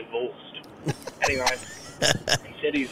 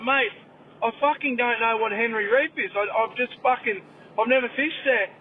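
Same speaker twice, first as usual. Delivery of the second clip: pitched-up mock exasperation; baffled protest; 7 semitones higher than usual. Client protesting to the masseuse about fishing, mock exasperated. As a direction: insist you have no idea. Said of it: "He's like".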